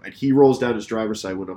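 usual speaking voice

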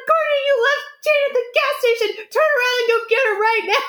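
very high mock-furious scolding; retold yelling. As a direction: imitate a frantic bride ordering you back. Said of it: "she's yelling at me".

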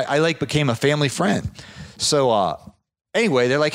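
ordinary talk

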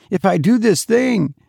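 high, frightened start that breaks off; begin, then falter in dread; terrified hesitation. A narrator's reading. Terrified man weighs deadly task.

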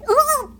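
high, drawn-out "ooh" while falling; tripping yelp. A narrator's reading. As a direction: yelp as you topple over. Pirates cry out as they trip.

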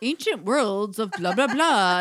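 loud, slow mock documentary narrator; hammed-up, drawn-out nature-show narration; National Geographic narrator. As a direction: boom it out like a grand documentary voiceover. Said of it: "It's like National Geographic".

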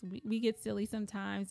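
ordinary talk, nothing marked

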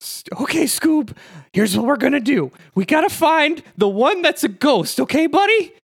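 high cartoon sidekick voice; Shaggy. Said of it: "Shaggy and Scooby".

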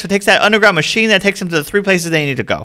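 normal talking voice

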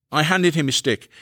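ordinary delivery, nothing marked

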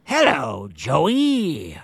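sing-song sinister clown greeting; creepy clown voice. Retold or imitated. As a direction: lure him in like a creepy clown. Voicing the sewer clown.

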